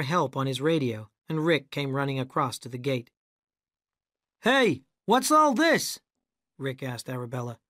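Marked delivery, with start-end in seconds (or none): 4.4-6.0 high, slow, loud demanding call; director voice. A narrator's reading. Rick arriving at the gate, demanding. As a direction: demand an explanation.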